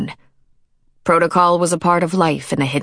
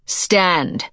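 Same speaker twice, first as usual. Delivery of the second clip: ordinary delivery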